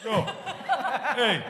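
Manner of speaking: bad New York accent; hammy New York accent. Shown as a demonstration. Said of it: "I do a really bad New York accent".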